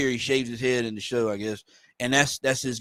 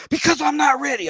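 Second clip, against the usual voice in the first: much higher, louder yelled line; mock yell; 13 semitones higher, 5 dB louder than usual. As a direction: scream it out, all at once. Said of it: "He just fucking yells it".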